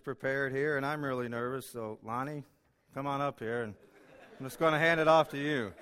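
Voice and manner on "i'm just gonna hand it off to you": laughing. Real, not acted. Nervous joke at start of sermon.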